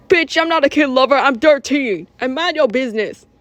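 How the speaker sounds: acted kid talking back; indignant retort. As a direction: snap back at the insult.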